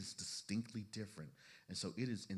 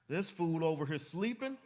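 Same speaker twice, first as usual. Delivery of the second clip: loud, high-pitched incredulous question; comic indignation, pitched way up; indignant outburst; 9 semitones higher, 10 dB louder than usual. Acted. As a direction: play the wide-awake spouse outraged at the sleeper. Acts out indignant sleepless spouse.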